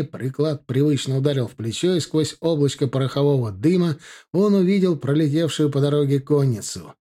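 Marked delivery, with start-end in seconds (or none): none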